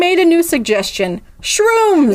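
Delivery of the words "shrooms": high, punchy exclamation; excited reveal. Acted. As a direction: announce it with glee. Dramatic reveal in the story.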